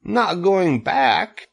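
high, drawn-out disbelief; stunned, stretched-out high question; alarmed cry. A narrator's reading. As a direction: question it in high-pitched dismay. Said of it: "he cried".

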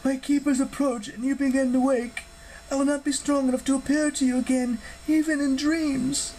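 high-pitched goddess warning a hero; high, solemn dream-visitor voice; urgent goddess. Acted.

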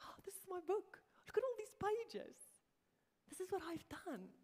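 high-pitched imitated wonder; very excited. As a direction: marvel in a light, high, excited voice. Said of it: "Very excitedly".

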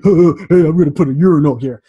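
loud, fast goofy voice; hammed-up goofball announcing a urinal; mock goofball. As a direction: play a careless goofball. Mock goofball artist quote.

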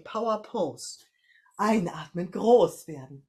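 loud, rousing coach call. Shown as a demonstration. Coach rouses the class into the power pose, loud and rousing. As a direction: pump the group up on the breath in.

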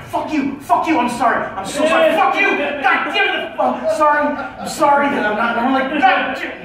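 high, strained curses with quick apologies; high pained swearing patient who keeps saying sorry; apologetic cursing in pain. Retold or imitated. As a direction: yelp and apologize at once. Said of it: "sorries in between every curse word".

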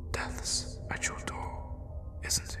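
very soft, slow whisper; hushed and drawn out; ominous whisper. Acted. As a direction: whisper it slowly to the dying. Murmurs to dying victim.

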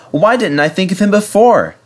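high, excited exclamation; thrilled with a sudden thought; sudden realisation. A narrator's reading. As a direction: burst out as the idea strikes. Edward has a sudden idea.

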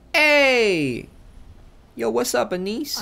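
high, loud cheery hail; loud friendly greeting. Real, not acted. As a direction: call out happily. Greeting an NPC loudly.

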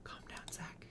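breathy whispered hush; real soft whisper. Real, not acted. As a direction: whisper to settle someone. Soothing upset Sim.

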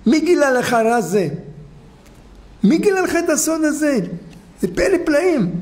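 the king gasps at the secret he was shown; amazed king. Retold or imitated. The king asks the goldsmith who told him the secret, amazed.

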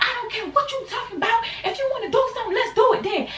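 very high, laughing dare; mock challenge. Speaker daring her friend to fight, high and laughing.

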